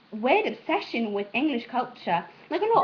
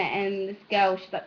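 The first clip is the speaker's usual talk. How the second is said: usual speaking voice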